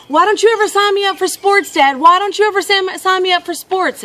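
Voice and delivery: put-on high, loud kid complaint; frustrated boy. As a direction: imitate a boy whining at his dad. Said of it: "clearly frustrated".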